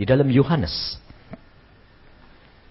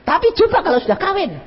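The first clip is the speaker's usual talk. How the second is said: ordinary talk, nothing marked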